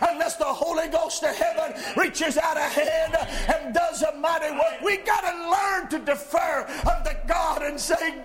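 loud, high shouted preaching; preaching at full force. Acted. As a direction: drive it home like a fiery preacher. Pastor exhorts congregation.

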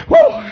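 high preacher's "woo"; revival whoop. Real, not acted. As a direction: let out an exultant whoop. Preacher whoops mid-sermon.

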